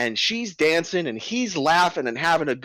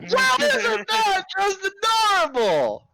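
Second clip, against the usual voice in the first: a fast-talking circus boss buttering up the bears; ringleader voice; 11 semitones higher than usual. Acted.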